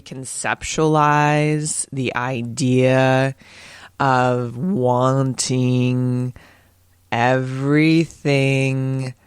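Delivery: extremely slow, each word stretched and held; drawn out. Shown as a demonstration. Host shows that gain takes time, exaggeratedly drawn-out words. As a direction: drag every word out as if time is crawling. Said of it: "what I did there".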